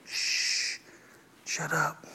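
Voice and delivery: breathless whisper, hey shh shut up; a playful whispered shut up to your spouse; whispered shush. Pretend. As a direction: hush them in a whisper. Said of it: "turn to each other every now and then and go".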